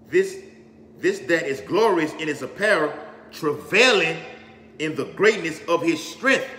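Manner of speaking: slow, emphatic Bible reading with weighty pauses; scripture declamation. Real, not acted. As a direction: proclaim the verse phrase by phrase, punching the key words.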